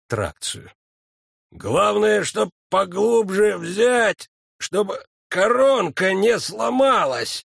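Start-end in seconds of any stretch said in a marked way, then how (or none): 1.5-7.5 slow, louder, effortful; pulling hard while talking; straining medic. A narrator's reading. Фельдшер тянет зуб. (напряжённо, с усилием)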